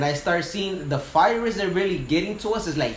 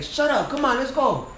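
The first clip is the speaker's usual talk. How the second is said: much higher, brusque command; impatient captain; 10 semitones higher than usual. Retold or imitated. Old captain hurries his scared crew along, brusque. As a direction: wave off the whining and push them on.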